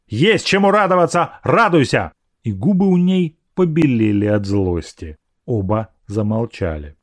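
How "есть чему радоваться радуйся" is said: higher-pitched angry jeer; savage cry. A narrator's reading. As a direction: jeer back with restrained fury. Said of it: "зверски закричала она опять". (злобно, с издёвкой)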